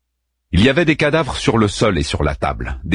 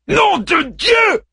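high furious roar; roaring curse; slower than usual. A narrator's reading. Daeman roaring at Caliban's taunts, furious. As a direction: bellow it in rage. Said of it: "rugit Daeman".

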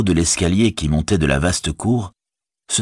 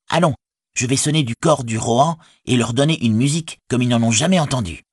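higher-pitched, rousing hobbit voice; rally your people with higher urgency; urgent rebel leader; 6 semitones higher than usual. A narrator's reading. Merry tells his companions to raise the Shire now, urgent.

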